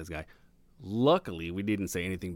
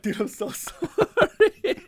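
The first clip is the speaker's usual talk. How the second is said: much higher and slower, laughing through the apology; 7 dB louder, much slower than usual. Retold or imitated. Caught insulting the boss over the truck speaker, laughing apologetically. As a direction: squeak out sorry while cracking up.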